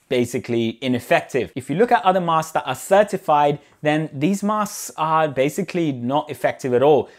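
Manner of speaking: normal talking voice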